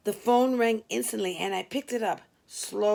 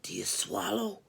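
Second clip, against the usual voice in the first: a low, softer whispered caller voice; low creepy whisper; 5 dB quieter than usual. Acted. Prank caller whispering a lewd question down the phone, low and hushed. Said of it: "a low voice asked".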